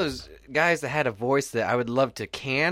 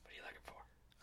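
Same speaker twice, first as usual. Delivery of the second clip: real whisper, very soft; 30 dB quieter than usual. Real, not acted. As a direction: whisper so the mic won't catch it. Asks co-host while recording paused.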